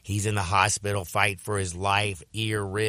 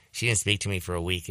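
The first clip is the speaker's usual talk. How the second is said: usual speaking voice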